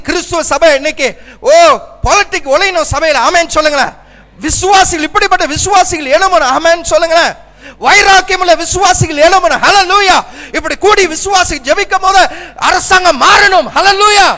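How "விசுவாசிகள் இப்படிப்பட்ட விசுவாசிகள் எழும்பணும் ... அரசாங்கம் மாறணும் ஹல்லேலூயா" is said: high, loud cries building to a climax; rallying preaching. Real, not acted. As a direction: rouse the church, climbing to a shout. Rallying cry to the congregation.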